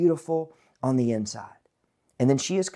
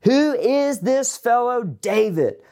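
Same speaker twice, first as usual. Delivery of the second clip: a slow, drawn-out sneer, pitched up; 7 semitones higher, slower than usual. Acted. Nabal sneering at David's young men, slow and scornful. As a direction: sneer at the messengers. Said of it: "Nabal sneered to the young men".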